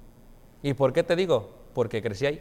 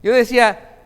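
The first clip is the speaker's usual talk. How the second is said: ordinary delivery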